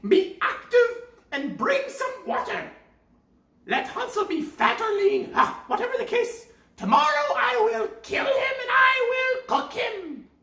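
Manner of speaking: high shouting witch voice; impatient witch. Acted. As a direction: bark the threat at Gretel. Impatient witch orders Gretel.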